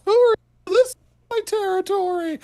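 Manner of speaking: very high, loud owl screech; Hootie. Acted. Game master mimicking an owl defending its territory, high squawking impression. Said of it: "like Hootie in the Owl House".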